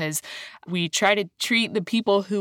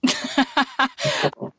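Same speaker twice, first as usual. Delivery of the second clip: high burst of real laughter; self-conscious laugh; 8 semitones higher than usual. Real, not acted. Host excusing her emotion to guest, laughing. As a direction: laugh off your own emotion.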